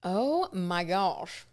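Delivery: slow, breathless flustered sigh. Real, not acted. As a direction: let it out slowly, overwhelmed. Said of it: "I'm flustered after reading that".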